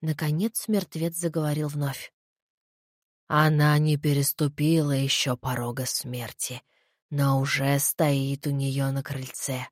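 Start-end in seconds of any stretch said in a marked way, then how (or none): 3.3-9.7 low, soft, slow voice of the dead; undead voice. Acted. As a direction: speak the omen from beyond death. Dead head prophesies.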